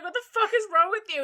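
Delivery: exasperated yell. Retold or imitated. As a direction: blurt it at a friend gone silly. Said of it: "and I was like".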